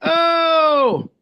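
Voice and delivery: high, loud falling "oh"; drawn-out whoop of greeting; excited shout. Real, not acted. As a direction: holler a long hello. Both hosts yell at a newcomer.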